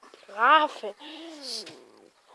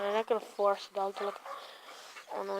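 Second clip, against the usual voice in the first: ordinary talk, nothing marked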